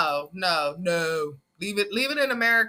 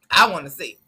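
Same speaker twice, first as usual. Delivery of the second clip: nosy; 3 semitones lower, 7 dB louder than usual. Real, not acted. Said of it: "I'm nosy".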